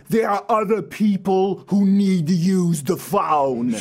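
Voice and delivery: slow, drawn-out movie-character voice; Dumb and Dumber guy. Acted. Host mimics the governor as a movie character, slow impression. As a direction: stretch it out like a comic movie oaf. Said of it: "sound like the guy in Dumb and Dumber".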